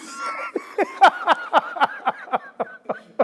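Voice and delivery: high squealing laugh; shrieking laughter. Real, not acted. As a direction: burst out laughing at the punchline. Laughing at own story.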